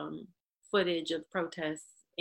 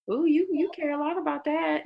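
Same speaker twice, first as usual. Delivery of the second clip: mocking quote; 9 semitones higher, 4 dB louder than usual. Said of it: "kinda like".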